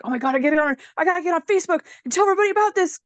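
gushing like she can't wait to tell everyone; excited rush. Retold or imitated. Telling herself to post on Facebook, excited and fast.

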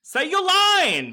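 very high, stretched-out squeal; high mock prompt. Host prodding co-host for her line, high squeal. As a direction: cue your co-host in a drawn-out, sky-high voice.

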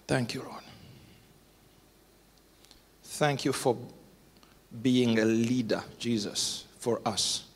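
closing prayer for leaders. Acted. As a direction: pray it humbly.

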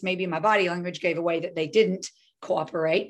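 ordinary talk, nothing marked

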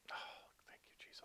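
breathy whispered thanks, barely audible; retold quiet prayer. Pastor shot in ambulance, thanking Jesus in whisper. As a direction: whisper it to yourself so nobody hears. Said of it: "But I'm doing it quietly".

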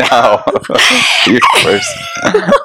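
high, slow laughing scold. Real, not acted. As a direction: drag it out through laughter.

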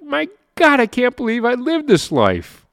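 high, wide-eyed exclamation of wonder; a burst of delighted disbelief; amazed disbelief. Retold or imitated. Retold amazed self-talk at coffee shop.

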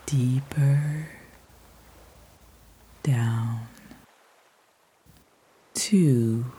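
long pauses, soft and whispered numbers; slow soothing countdown. Acted. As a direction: count them down into sleep. Counting down stairs into sleep.

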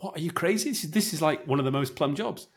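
high, astonished what, are you crazy; incredulous boss. Retold or imitated. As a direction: play the boss who can't believe it. Boss reacting in disbelief.

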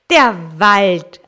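loud, emphatic answer; Antwort im Chor. A narrator's reading. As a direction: call it out like two children at once. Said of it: "antworteten beide Kinder aus einem Munde". (laut im Chor gerufen)

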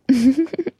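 short, high chuckle; real chuckle. Real, not acted. Host pausing for a sip of water, real chuckle. Laughs over needing water.

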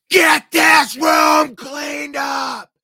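high, roaring teacher's yell; slow, explosive bellow at the pranksters; furious shout. Retold or imitated. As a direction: blow up at the class. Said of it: "He just exploded".

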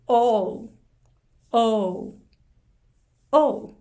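"O" started with the mouth open vertically, then glides to u; demo vowel O. Shown as a demonstration. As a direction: say "O" as a rounded o sliding into u. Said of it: "Oは口を縦にしてスタート". (「O」を口を縦にして始め、うへ動かす)

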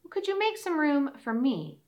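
a tired fawn asking to come in; young deer voice. Acted. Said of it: "said Nimble Deer".

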